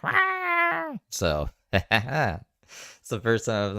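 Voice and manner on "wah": high-pitched mocking "wah"; mock wail. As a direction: imitate her with a drawn-out high wail. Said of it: "She was like".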